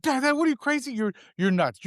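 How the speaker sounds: put-on very high, flustered kid voice; mock-horrified daughter scolding dad; imitated alarm.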